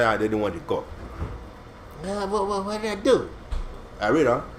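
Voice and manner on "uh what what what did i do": high, softer mock innocent whine; fake disappointment. David faking disappointment to Achish, soft mock whine. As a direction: pretend to be crushed, not convincingly. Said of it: "you're acting like you're disappointed".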